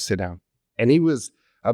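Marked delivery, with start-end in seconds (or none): 0.0-0.4 low, quiet, curt command from a big guy; the diner's curt order. Retold or imitated. Regular tells the server to sit, low and curt. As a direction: tell her to sit, flat and low. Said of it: "He goes".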